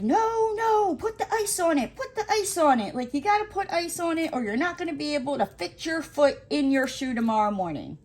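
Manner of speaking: retelling urging friend to ice her foot, insistent; insistent retold scolding. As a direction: urge a stubborn friend firmly.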